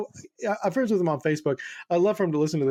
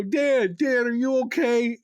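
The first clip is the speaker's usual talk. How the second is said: worried call; 8 semitones higher, slower than usual. Retold or imitated. Worried call to hurt friend.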